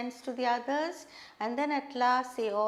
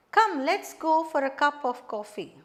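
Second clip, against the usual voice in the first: louder invitation with the tone dropping on "coffee"; falling intonation; 2 semitones higher, 5 dB louder than usual. Shown as a demonstration. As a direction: invite firmly, voice falling through "cup of coffee". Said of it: "Falling intonation".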